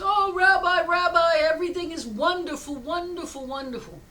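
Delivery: high, loud gush that eases at the end; acted elation. Villager reporting his relief to rabbi, acted elation. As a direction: gush in hammed-up delight. Villager overjoyed without the goat.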